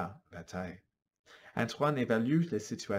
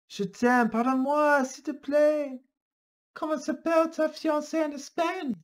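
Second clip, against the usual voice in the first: pleading; 5 dB louder than usual. Acted. Lovers' exchange, high voice.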